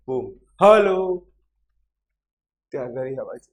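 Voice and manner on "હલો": loud echo-test hello; one louder, drawn-out demo shout; demonstrated call-out. Shown as a demonstration. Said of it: "જોરથી બૂમ પાડે છે".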